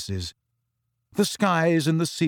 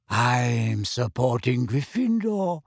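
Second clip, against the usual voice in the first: lightly voiced dreamy girl; slower than usual. Luna voiced by narrator.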